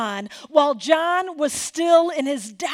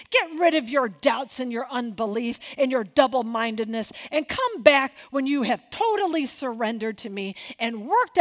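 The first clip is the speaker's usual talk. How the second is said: annoyed, indignant Jesus; 4 dB louder than usual. Pretend. Jesus scolding a doubting father, annoyed and loud. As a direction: tap your toe and scold the doubter. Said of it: "Jesus is kinda standing there, you know, like, tapping his toe, arms crossed, totally annoyed".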